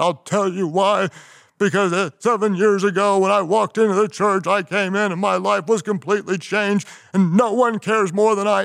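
high, strained tearful plea; imitated crying. Candidate answers why you, imitated tearful outpouring. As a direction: act out a big man choking up. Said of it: "and he started crying".

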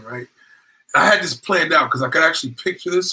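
ordinary talk, nothing marked

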